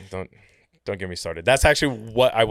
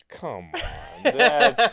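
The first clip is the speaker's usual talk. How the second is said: mock offended; 13 semitones higher, 5 dB louder, much slower than usual. Mock offence at being misplaced.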